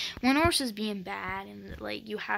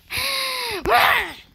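loud gasp; 14 semitones higher, 12 dB louder than usual. Real, not acted. Mispronounces friend's channel name.

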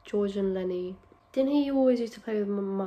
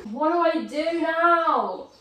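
high, slow, loud wail; upset; 7 semitones higher, 5 dB louder, slower than usual. Real, not acted. Vlogger whose lunch plans the mouse ruined, upset. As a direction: wail it helplessly. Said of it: "I'm so upset".

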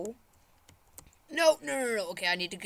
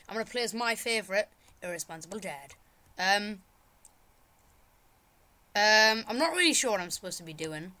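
ordinary talk, nothing marked

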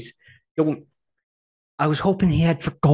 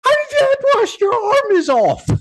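very high, loud, outraged squawk; hammed-up disbelief, stretched at the end; mock incredulous yell; 6 dB louder than usual. Acted reply to a badly wounded fighter, mock incredulous yell.

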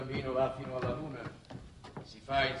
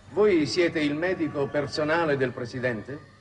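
raised over the roar; 2 semitones higher, 8 dB louder than usual. Acted. Talking over rocket launch pressure.

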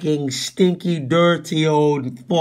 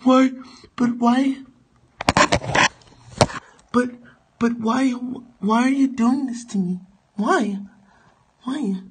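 higher, softer whimpering why; whiny pleading victim; 8 semitones higher, 4 dB quieter, faster than usual. Acted. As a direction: beg like a bullied kid.

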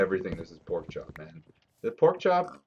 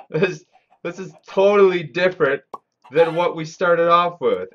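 loud, high disbelief at the new world; astonished; 8 semitones higher, 10 dB louder than usual. Real, not acted. Streamer shocked at the unfamiliar map, loud and incredulous. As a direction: blurt it out, baffled and loud. Lost in a changed game world.

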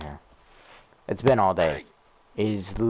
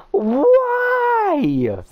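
long high wailing why, louder; anguished why; 6 dB louder than usual. Real, not acted. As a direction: wail it up high to the heavens. Pleading to game.

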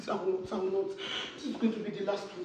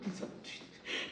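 frightened whisper; 15 dB quieter, slower than usual. Acted. Frightened wife pleads.

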